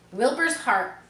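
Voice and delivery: plain reading voice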